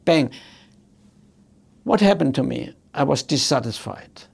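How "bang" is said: normal talking voice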